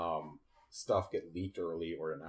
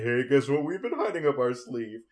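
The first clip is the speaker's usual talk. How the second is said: louder, higher mock hype; excited announcement; 6 semitones higher, 9 dB louder than usual. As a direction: announce a big secret with put-on excitement. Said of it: "get excited and say".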